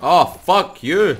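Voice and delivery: loud, high and slow curse; angry shout. Real, not acted. Gamer shouting at a boss kicking him, angry yell. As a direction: yell it at the enemy.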